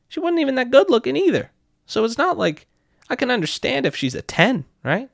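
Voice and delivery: high-pitched, exasperated disbelief; incredulous rant. Retold or imitated. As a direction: voice jumps way up in outraged disbelief. Ranting at unfair bouncer treatment.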